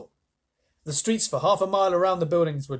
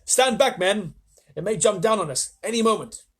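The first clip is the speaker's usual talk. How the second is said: high, loud order; police chief warning his men, shouting; command shout; 6 semitones higher, 5 dB louder than usual. Acted. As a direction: order the men back, urgent. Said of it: "yelled the chief of police".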